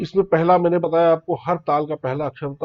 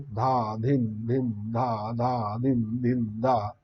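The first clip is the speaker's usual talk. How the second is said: bols at double speed, softer; medium tempo bols; 3 semitones lower, 4 dB quieter, much slower than usual. Shown as a demonstration. Teacher demonstrates madhya lay to students, medium pace. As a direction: keep a steady middle pace. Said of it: "उसके दुगने speed में बजा सकते हैं".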